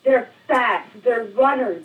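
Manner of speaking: urgent insistence. Acted. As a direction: drive the warning home. Insisting after being questioned about danger.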